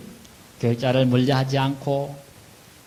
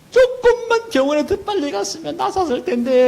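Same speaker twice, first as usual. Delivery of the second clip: high, loud mock funeral wail; acted wailing; 10 semitones higher, 5 dB louder than usual. Mourner crying out to the deceased at a funeral, acted loud wailing. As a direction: lament loudly as if keening over the dead. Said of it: "하면서 우는 그런 거지".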